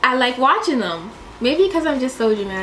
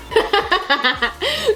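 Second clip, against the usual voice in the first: high, awestruck gasp; breathless gasp; 9 semitones higher than usual. Shown as a demonstration. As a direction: gasp as if your breath was taken away. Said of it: "my breath be taken away".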